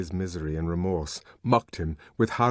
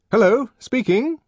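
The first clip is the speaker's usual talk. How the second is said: phone answer; 8 dB louder than usual. A narrator's reading.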